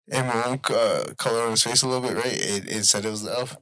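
usual speaking voice

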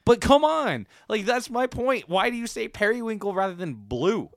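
exasperated yelling. Real, not acted. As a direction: push the point in frustration. Exasperated argument about periwinkle.

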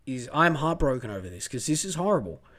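low, heartbroken and subdued; really upset. Real, not acted. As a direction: let the hurt pull the voice down. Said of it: "I'm getting really upset over this".

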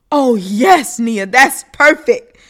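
loud, drawn-out praise; eager photographer. Acted. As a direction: gush over the shot. Photographer directing.

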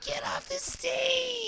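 high, slow mocking heckler voice. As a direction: heckle the comic off the stage.

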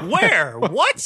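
loud, high, disbelieving outburst; real incredulity. Real, not acted. Disbelieving reaction to friend's claim.

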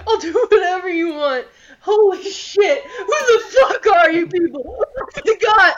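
loud, high, sobbing terror; panicked crying scream. Acted. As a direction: scream it through tears, begging. GM voices terrified NPC screaming.

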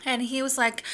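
ordinary delivery

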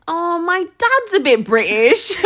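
slow, lifted, drawn-out explanation; self-quote. Retold or imitated. Explaining their accent to someone asking, slow and lilting. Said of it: "You say it like that?".

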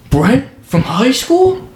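slow, high-pitched mock recognition; feigned surprise. Friend faking a chance reunion at the coffee line, feigned surprise. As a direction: draw it out high, ending on an incredulous rise. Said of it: "fake walk up".

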